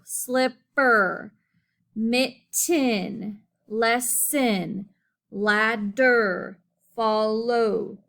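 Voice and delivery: slow, with each first syllable punched; first syllable stressed. Shown as a demonstration. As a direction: lean on the first beat of slipper, mitten, lesson, ladder, follow. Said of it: "the first syllable sound of each word is stressed".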